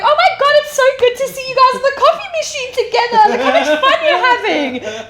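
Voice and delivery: high, loud gushing customer voice with laughter; high bubbly customer impression, laughing. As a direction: mimic delighted customers cooing over the baristas.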